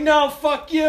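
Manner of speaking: high, loud laughing refusal; jeering shout back. Retold or imitated. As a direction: holler it back at a drunk buddy. Friends shout back from driveway.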